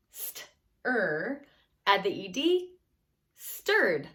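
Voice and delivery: st-er, add the E D, then stirred as one word; sounding out. Shown as a demonstration. Teacher builds stirred from its base word for students. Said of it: "Get the base word".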